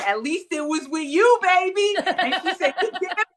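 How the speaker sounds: ordinary talk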